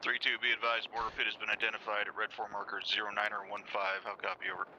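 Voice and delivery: tinny radio voice over static; crackly military radio. Acted. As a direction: speak as if through a field radio. Radio report of mortar pit.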